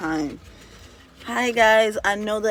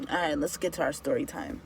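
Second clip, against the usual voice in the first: ordinary delivery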